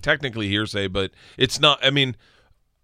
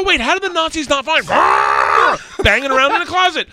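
high, loud, baffled outburst; loud incredulity; 13 semitones higher, 7 dB louder than usual. Retold or imitated. Retelling his clueless question to the club, loudly incredulous. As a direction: act out dumbfounded disbelief at full volume. Retold clueless disbelief on stage.

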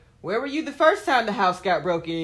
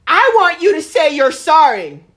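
demanding; 7 semitones higher, 12 dB louder than usual. Retold or imitated. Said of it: "neighbor demands an apology".

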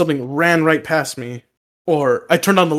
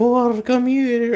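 ghostly lady; 7 semitones higher, much slower than usual. Retold or imitated.

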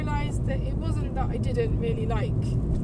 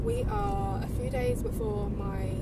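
ordinary talk, nothing marked

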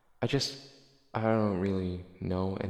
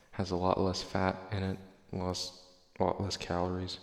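ordinary delivery

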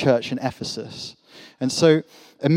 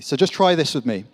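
ordinary talk, nothing marked

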